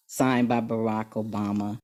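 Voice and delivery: ordinary delivery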